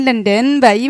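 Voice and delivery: normal talking voice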